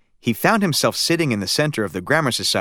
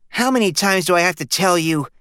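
put-on higher, exasperated boy cutting in; impatient boy interrupting; 7 semitones higher than usual. A narrator's reading. Stanley interrupts his parents, exasperated boy's voice. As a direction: cut in, fed up. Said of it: "interrupted Stanley".

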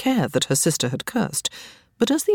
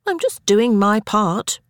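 higher, slow, innocent disclaimer; demurring; 5 semitones higher, slower than usual. A narrator's reading. Elizabeth excuses herself to an angry Sarah, demurring. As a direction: demur as if blameless. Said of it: "Elizabeth demurred".

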